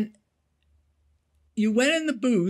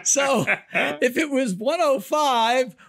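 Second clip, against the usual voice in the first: usual speaking voice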